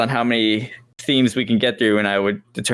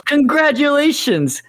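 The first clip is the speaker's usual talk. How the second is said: excited; 12 semitones higher than usual. Pretend. Hyped announcement.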